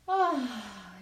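long open breath out; audible exhale. Shown as a demonstration. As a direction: breathe out loud on ah. Breathing exercise, exhale sound. (exhalación audible)

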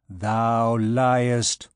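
cold, level accusation from a shadow; coldly. A narrator's reading. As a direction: repeat it coldly, without raising the pitch. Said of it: "said the shadow coldly".

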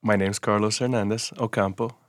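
ordinary delivery, nothing marked

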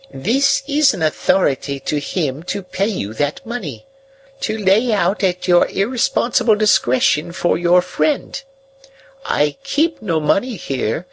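put-on high, thin old woman's voice, stiff and formal; aged lady voice. Old woman handing the young man an authority, high formal voice. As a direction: speak as a proud old recluse, high and measured.

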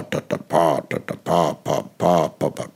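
fading rhythm demo; German stress rhythm. Shown as a demonstration. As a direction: tap out "pa, ta, ta" with first-syllable stress. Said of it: "im Deutschen machen wir pa".

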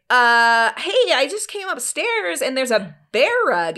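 retold wary confrontation. Wife phoning husband about the bear rug, pointedly high-pitched. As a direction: pitch it up, feigning innocent discovery.

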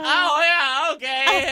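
very high, loud mock squeal; hammed-up falsetto admission; busted reaction. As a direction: squeak it out as if caught red-handed. Acting out being caught out.

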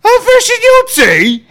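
high, slow put-on posh English; plummy mock-posh voice, drawn out; mock posh accent. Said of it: "mine's quite posh".

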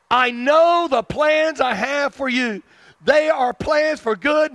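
high, emphatic restating of scripture; impassioned preaching. Real, not acted. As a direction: drive each promise home, building to a shout. Preaches the verse back.

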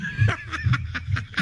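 high airy wheezing laugh; breathless laughing fit. Real, not acted. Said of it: "such a contagious laugh".

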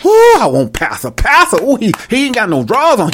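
high, hammed-up cooing over the preacher; mock swooning congregant. Congregant lusting after the dancing pastor, mock swooning. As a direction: swoon in parody, pitch leaping up.

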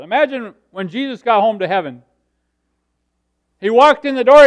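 impassioned preaching. Real, not acted. Preacher picturing Jesus arriving home in heaven, building to a shout. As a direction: swell loudly into the big moment.

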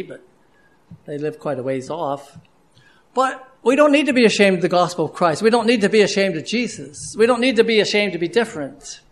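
ordinary talk, nothing marked

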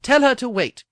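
loud, high hurried cry. Acted. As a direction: call it out in a rush. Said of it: "he cried".

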